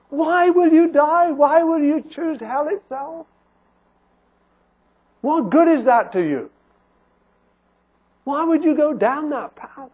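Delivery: high, urgent appeal to sinners; persuasive pleading. Acted. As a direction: implore them to turn back. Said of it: "a persuasive argument".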